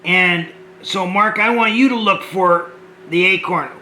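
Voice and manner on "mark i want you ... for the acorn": ordinary delivery, nothing marked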